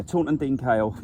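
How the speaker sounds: ordinary delivery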